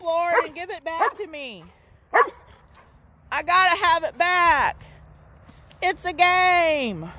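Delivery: a believer whining to the Lord for his sin, mock pleading; whiny begging. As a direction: beg for the sin back like a child.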